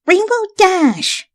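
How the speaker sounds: high, slow warning name; sharp scolding call. A narrator's reading. Twilight scolds Rainbow to apologize properly. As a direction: bark her name.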